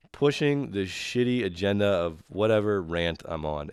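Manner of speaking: ordinary delivery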